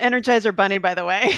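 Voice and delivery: amused playful praise; laughing. Real, not acted. Teasing compliment with laugh.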